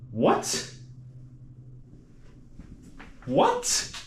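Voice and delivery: high, disbelieving double take; can't believe what you see; surprised. Real, not acted. Shocked at the new bathroom.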